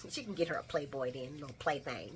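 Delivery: sly quiet tease; hushed sly aside. Retold or imitated. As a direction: murmur it like a naughty secret. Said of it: "And I'm like".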